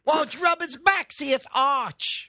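very high, exaggerated fake British; mocking British accent. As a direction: tease in a silly high British voice. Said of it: "I'm mocking their accent".

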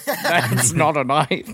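slow, drawn-out mock Australian accent; laughing Australian movie-hero impression; Paul Hogan. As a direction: put on a broad Aussie accent, stretching each word. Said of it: "would you say no to Paul Hogan?".

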